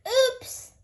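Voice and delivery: high startled oops; surprised oops. A narrator's reading. Peppa falling on her bottom, surprised. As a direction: catch yourself as you fall. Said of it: "cried Peppa".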